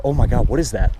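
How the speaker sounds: ordinary talk, nothing marked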